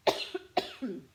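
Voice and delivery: another sharp cough, choking a little; coughing fit. Real, not acted. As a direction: keep coughing on something swallowed wrong. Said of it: "that went down the wrong way".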